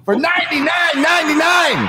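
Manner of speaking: high, loud shout of the amount; hype shout. Pretend. Host hailing a big donation to the stream, high hype shout. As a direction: belt out the donation figure, stretched high.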